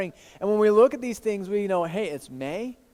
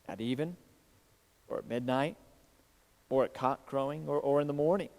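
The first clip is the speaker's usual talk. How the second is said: scripture reading; 3 semitones lower than usual. Acted. Pastor reads Jesus' warning to the congregation, hushed solemn reading.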